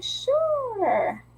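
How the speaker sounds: very high, drawn-out "sure"; perky agreement. Retold or imitated. Answering an invitation to team up, high playful agreement. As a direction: stretch it out up high, then drop.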